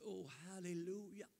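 high, crooning hallelujah; soothing praise. Retold or imitated. Preacher comforting his sister in the water, crooning. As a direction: coo it like soothing a child.

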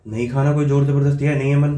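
loud irritated refusal as part of the prank; mock anger. Faked anger in ignoring prank.

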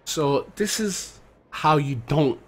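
low, measured voice-over; unhurried, low-key storyteller setup; video narration. Real, not acted. Voice-over introducing a gameplay clip.

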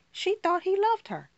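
the refrain turned into a high, hurting cry; anguished high refrain. Acted. Refrain returns, pitched high.

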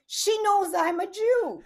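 high, slow imitated Russian accent; imperfect Russian accent. Dad insisting Alexa knows him, high imperfect Russian accent. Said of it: "I can't do the right Russian accent".

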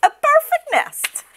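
very high goose voice; very high goose delight. Acted. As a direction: gush over the nest as the goose. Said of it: "she cried".

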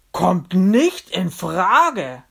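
loud, drawn-out veto; slow, forceful objection; emphatic refusal. Acted. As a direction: burst in and forbid it outright. Julius bursts in, forbidding party.

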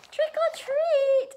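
very high, loud, drawn-out call; sing-out Halloween call. A narrator's reading. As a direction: call it out in playful excitement. Story mummy calls to little monster.

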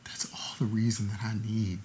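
whispered love to ex-fiancée; tender whisper. Acted. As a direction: forgive her gently, almost silent. Tender reassurance.

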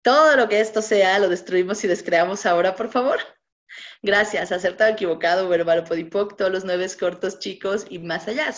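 smooth, practised litany of words; ritual clearing recitation. Acted. Facilitator reciting the clearing statement to her audience, steady chant.

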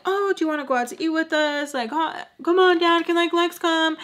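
high-pitched child imitation; young kids asking. Brothers begging dad to let sister come, high plea. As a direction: ask eagerly, imitating little brothers. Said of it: "asking me".

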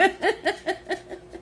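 a high, breathy burst of giggling; high giggle. Real, not acted. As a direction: crack up at yourself. Laughs at own cow sound.

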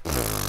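dismissive lip-blown scoff; pfft. Real, not acted. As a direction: blow out a quick scornful pfft. Scoff at seven years bad luck.

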